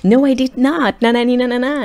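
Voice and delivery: high, sing-song na na na taunt; mocking childish denial. Mocking his girlfriend's denial, childish sing-song. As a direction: mimic a child brushing him off.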